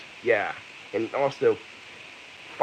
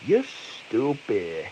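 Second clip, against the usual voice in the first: ordinary talk, nothing marked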